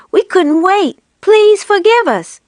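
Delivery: high, loud and gushing; breathless joy and apology together; excited cry. A narrator's reading. Missus Fox gushing an apology to her returning husband. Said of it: "cried Missus Fox".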